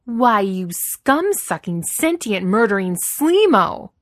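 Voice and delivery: loud, high, drawn-out insults; losing her temper. A narrator's reading. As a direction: hurl each insult slowly in rising fury. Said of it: "close to completely losing her temper".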